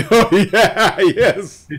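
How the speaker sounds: high, loud words through laughter; laughing. Real, not acted. Agreeing with co-host's remark, laughing.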